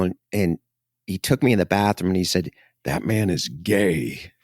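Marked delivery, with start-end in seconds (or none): 2.8-4.3 slow, grave dad; a hint of a shocked father, very slow; hushed stern dad. Dad in bathroom, retold.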